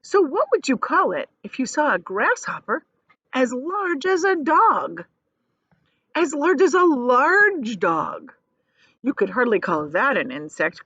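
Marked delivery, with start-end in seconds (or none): 3.3-8.3 slow, drawn-out emphasis; emphatic. Shown as a demonstration. Teacher stressing the grasshopper's size to pupils, slow. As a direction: stress how large it is. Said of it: "reading to children".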